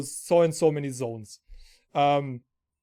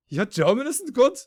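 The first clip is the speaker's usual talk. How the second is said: higher-pitched incredulous question; retold speechless disbelief; 6 semitones higher, 3 dB louder than usual. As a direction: act out being dumbfounded by the claim. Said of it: "We were speechless".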